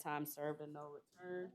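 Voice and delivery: ordinary talk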